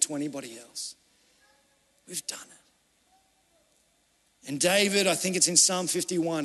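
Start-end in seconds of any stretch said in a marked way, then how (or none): 2.1-4.5 breathless, very slow whisper; whispered confession. Real, not acted. Preacher confessing to the congregation, whispered. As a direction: breathe the words out almost silently.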